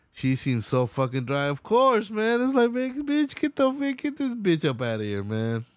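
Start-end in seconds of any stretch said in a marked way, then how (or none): 1.5-5.7 much higher, exasperated outburst; exasperated, pitch way up; disgusted rant. Real, not acted. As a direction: throw her out in disbelief. Ranting at the interviewer.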